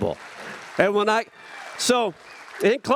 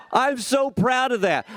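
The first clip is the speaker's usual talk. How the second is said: proud exclamation; 8 semitones higher than usual. Real, not acted. Proud declaration to crowd.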